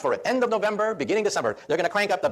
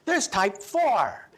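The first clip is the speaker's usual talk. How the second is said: put-on higher, childish insistent voice, slow at the end; pesky little boy; 5 semitones higher, much slower than usual. Boy tugging at the professor's pants, insisting in a high childish voice. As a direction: play a ten-year-old pestering the professor. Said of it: "he said".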